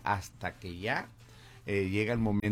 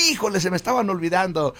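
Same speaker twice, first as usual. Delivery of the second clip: excited; 8 semitones higher, 11 dB louder, faster than usual. Retold or imitated. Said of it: "Y yo decía".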